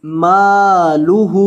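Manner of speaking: मालुहु slow, with both मा and हु stretched long; मालुहु with both vowels held long; long meem and ha. Shown as a demonstration. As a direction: draw out the मा and the हु in मालुहु. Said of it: "मीम को भी लंबा करेंगे और हा को भी लंबा करेंगे".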